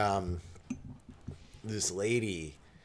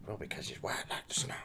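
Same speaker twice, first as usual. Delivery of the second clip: whispered; 4 semitones lower, 6 dB quieter than usual. Retold or imitated. Old lady's punchline.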